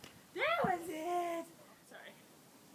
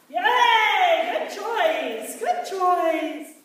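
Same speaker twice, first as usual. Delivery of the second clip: loud, gushing praise for the dog; excited dog praise; 10 dB louder, slower than usual. Real, not acted. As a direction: pour on the praise. Trainer praising dog loudly.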